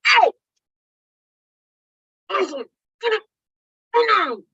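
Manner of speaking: high, firm mock command; mom commanding. Mom telling her daughter to get up, high and firm. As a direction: order the kid off the floor.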